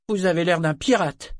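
put-on high, loud child's verdict; child declaring. A narrator's reading. Boy tells Brachen he looks like a pirate, high loud child voice. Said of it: "décréta-t-il".

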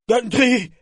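high, loud frantic call; panicked cry. Acted. Torg fleeing, calling the mate in panic. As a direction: scream for help in terror. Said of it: "cria-t-il éperdu".

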